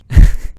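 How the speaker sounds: short breathy mocking laugh; mock cackle. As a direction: laugh at him like a bully. Teacher laughs at late student.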